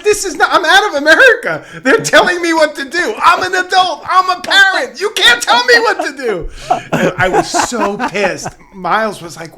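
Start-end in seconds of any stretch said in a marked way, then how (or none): 0.0-6.5 high, loud, laughing mock outrage; indignant rant. Father retelling his outrage at a London cinema, mock indignant and laughing. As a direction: rant like an offended tourist, half laughing. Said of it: "I was like".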